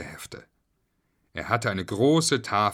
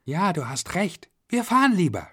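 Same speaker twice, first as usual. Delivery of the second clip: put-on high little-girl voice; high, rising kid's voice; Julia; 9 semitones higher, 3 dB louder than usual. A narrator's reading. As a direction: agree like a child in on the plan. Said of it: "stimmte Julia ihm zu".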